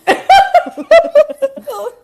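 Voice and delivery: loud, very high cackle; real high, loud laugh; burst of laughter. Real, not acted. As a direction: shriek with laughter. Laughing at thought of hanging up.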